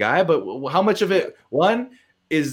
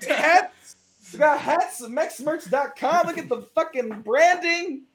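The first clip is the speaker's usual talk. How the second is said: high, slow cheesy pitchman; ad announcer voice; 12 semitones higher, slower than usual. Acted. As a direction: sell the hats like a TV ad. Mock ad for his merch.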